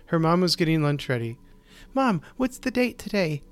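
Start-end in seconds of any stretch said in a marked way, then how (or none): 1.9-3.4 put-on high, eager child voice; little girl voice. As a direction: ask Mom like an excited kid. Child asks mother.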